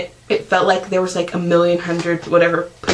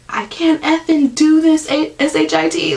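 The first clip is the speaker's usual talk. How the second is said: mock scream; 8 semitones higher than usual. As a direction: scream it in labor pain, retold. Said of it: "Like, I screamed it".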